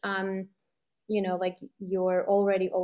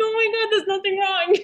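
high, loud, half-laughing, half-crying; giddy tearful relief; laughing through tears; 12 semitones higher, 7 dB louder than usual. Real, not acted. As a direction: break into laughter as the relief hits.

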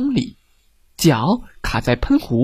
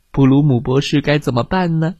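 ordinary delivery

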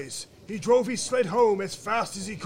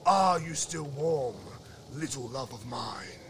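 unhurried, lower royal voice; frost king; 6 semitones lower than usual. Acted. Frost king's last, tender question.

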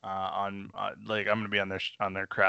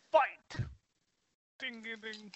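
ring announcer; 7 semitones higher, 10 dB quieter, much slower than usual. Pretend. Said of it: "with the radio announcer's voice".

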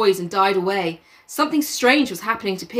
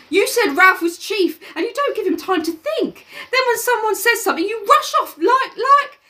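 much higher, quickening schoolboy rant; high boy complaining about the group; Piggy voice, ranting; 13 semitones higher, 3 dB louder than usual. Acted. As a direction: rattle off the complaint. Piggy voice held.

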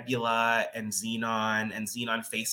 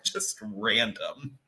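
ordinary delivery

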